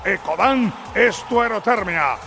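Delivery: upbeat radio ad voice; ad read. Acted. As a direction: sell it like a live radio spot. Live ad read on air. (lectura de anuncio radiofónico)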